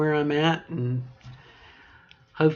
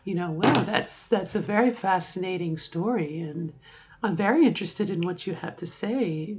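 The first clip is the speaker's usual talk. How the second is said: an attentive friend's raised feminine voice; higher, interested voice; 5 semitones higher than usual. Shown as a demonstration. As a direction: sound fascinated, pitched up. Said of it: "talk a little bit higher".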